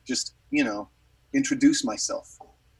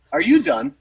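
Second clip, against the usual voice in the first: mildly impatient retold question, louder and higher; curt interruption; 4 semitones higher, 7 dB louder than usual. Rock star cutting off a gushing fan, mildly impatient. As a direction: imitate cutting off a rambler, pitch jumping on the question. Idol cuts off rambling fan.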